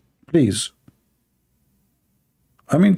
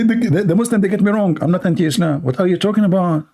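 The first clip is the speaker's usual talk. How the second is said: ordinary delivery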